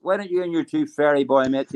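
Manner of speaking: usual speaking voice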